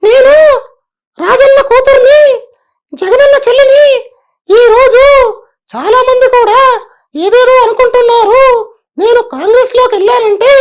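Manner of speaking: high, loud female politician voice; imitating Sharmila at a press meet, high and loud; Sharmila impression. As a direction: speak like a woman leader giving a speech. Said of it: "ladies voice అంటే షర్మిలది try చేసిన".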